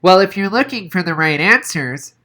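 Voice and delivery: put-on high, puzzled kid voice; bewildered Noah. A narrator's reading. Noah asking Donovan, bewildered, in a high voice. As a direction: sound honestly baffled. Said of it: "Noah seemed genuinely bewildered".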